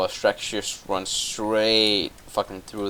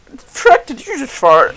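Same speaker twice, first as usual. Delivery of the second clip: much higher, louder cartoon donkey yelp; squeaky mock Donkey; 12 dB louder, slower than usual. Kid voicing Donkey asking Shrek about a fart, high squeal.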